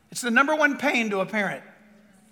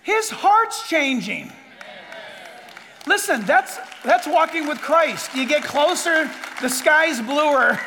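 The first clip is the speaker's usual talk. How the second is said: loud, fervent pulpit preaching; preaching at full force; 6 dB louder than usual. Acted. Preacher urging his congregation about walking with Christ, loud and fervent. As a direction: build it up and drive it home.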